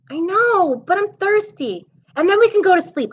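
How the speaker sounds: put-on pleading little boy at bedtime, voice up high; a kid bargaining to stay awake; high child voice.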